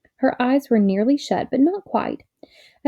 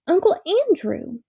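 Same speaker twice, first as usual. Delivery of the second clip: high call of sudden recognition; shouted name; 9 semitones higher than usual. A narrator's reading. Dickory remembering, a high mild shout. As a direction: cry it out as it comes back. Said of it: "he shouted out".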